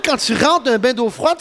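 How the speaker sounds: normal talking voice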